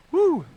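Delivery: high "woo" cheer; excited whoop. Real, not acted. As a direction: let out a high-pitched whoop. Cheer during live contest.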